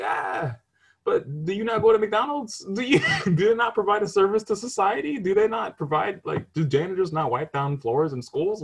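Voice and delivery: high, loud laughing protest; incredulous laughing rebuttal; laughing argument. Retold or imitated. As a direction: argue back through laughter. Arguing back laughing.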